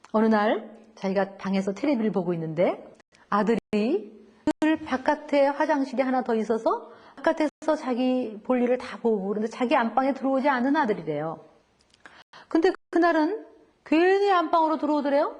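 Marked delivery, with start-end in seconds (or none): none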